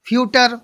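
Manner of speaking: "फ्यूटर" with yu glide and hard T, no CH; wrong pronunciation. Shown as a demonstration. Teacher warning how not to read feature, fyu-tar mispronunciation. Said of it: "इस तरीके से नहीं पढ़ेंगे".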